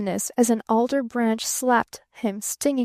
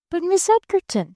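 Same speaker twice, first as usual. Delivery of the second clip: higher, a stammering puzzled protest; perplexed stammer; 7 semitones higher than usual. A narrator's reading. Barton protests as she dismisses him, puzzled and higher. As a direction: blurt it out, thrown. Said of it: "stammered Barton perplexedly".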